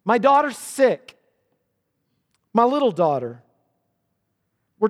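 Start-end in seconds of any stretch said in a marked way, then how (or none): 0.0-3.4 a father's desperate, louder plea, slowed on the first words; panicked plea. Acted. Jairus begging Jesus to save his daughter, panicked. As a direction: plead for your dying daughter. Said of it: "It was a panicked plea".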